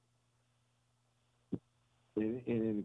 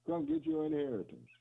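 much higher, ringing call. Retold or imitated. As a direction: sing it out high like an invitation. Quoting the Father's call.

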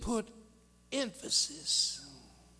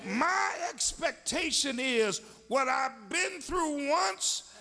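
higher, climbing preacher's cry; preaching at full force; 6 semitones higher than usual. Acted. Preacher rousing the congregation, full-force preaching. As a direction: lift the church with it.

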